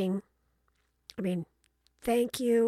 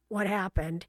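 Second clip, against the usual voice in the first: ordinary delivery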